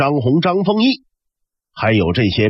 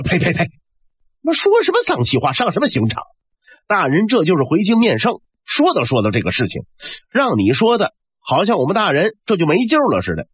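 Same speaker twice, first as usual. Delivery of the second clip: high, indignant rebuke; scolding retort; 7 semitones higher than usual. Acted. Li Cheng rebuking the servant for unlucky talk, sharp and high. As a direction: spit out the words to chase off bad luck.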